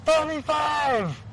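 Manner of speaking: high, slow drawn-out call; called-out number. Retold or imitated. New prisoner calls out a number to the room, high and slow. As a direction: stretch the number out hopefully. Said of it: "the guy looks up and goes".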